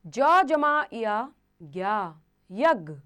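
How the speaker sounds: ज जमा या ज्ञा यज्ञ stretched out very slowly; slow spelling demo. Shown as a demonstration. Teacher slowly sounding a conjunct for children. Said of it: "जैसे".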